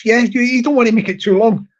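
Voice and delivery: normal talking voice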